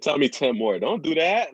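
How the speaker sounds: high loud mock trainer voice; playful shout. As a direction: shout it like a gym coach teasing.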